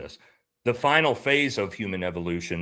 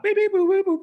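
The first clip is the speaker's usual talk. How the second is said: robot beeps; 4 dB louder than usual. Retold or imitated. Imitating the robot server.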